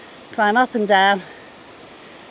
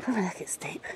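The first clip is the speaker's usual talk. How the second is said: whisper; 12 dB quieter than usual. Real, not acted. Nervous on steep slippery rock.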